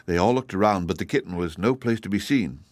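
ordinary delivery

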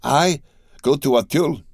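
high, dismayed echo of the order; aghast. A narrator's reading. Steward balks at going to Auteuil, aghast and high. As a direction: recoil at the very idea.

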